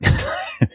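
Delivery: breathy high-pitched giggle; a quick amused titter. Real, not acted. Giggle at his own idea.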